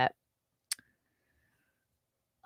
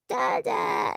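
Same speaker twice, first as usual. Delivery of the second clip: put-on baby voice. The little girl calling to the pilot, high baby shout. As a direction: call out like a tiny child. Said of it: "and she's like".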